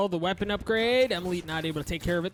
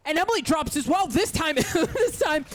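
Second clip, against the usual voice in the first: excited play-by-play, higher and louder; a laughing, rising hype call; esports caster; 6 semitones higher, 5 dB louder than usual. Real, not acted. Caster calls another upset round.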